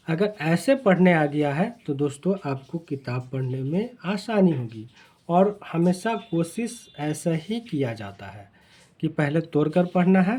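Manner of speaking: usual speaking voice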